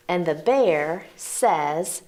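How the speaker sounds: put-on slow, drawn-out lead-in, inviting children to join; slow cue line. Shown as a demonstration. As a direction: stretch "and the bear says" for kids to chime in. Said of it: "we're gonna hear that phrase again".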